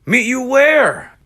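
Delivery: high loud yell. Acted. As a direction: yell into the dark. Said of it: "yelled in the dark".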